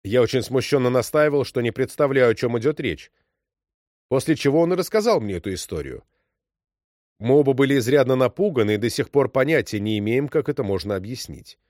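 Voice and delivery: ordinary delivery, nothing marked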